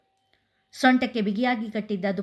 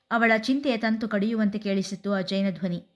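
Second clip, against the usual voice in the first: ordinary delivery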